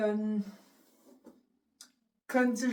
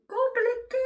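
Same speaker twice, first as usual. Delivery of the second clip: "Compliquée" jumped way up high and louder, a comic squeal; squeaky high mock wail; 13 semitones higher, 5 dB louder than usual. Shown as a demonstration. Vlogger dreading her first night alone, comic high squeal. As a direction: echo "Compliquée" as a much higher, louder, whiny squeak.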